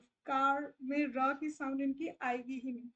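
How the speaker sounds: normal talking voice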